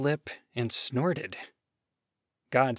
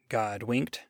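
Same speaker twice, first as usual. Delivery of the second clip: ordinary delivery, nothing marked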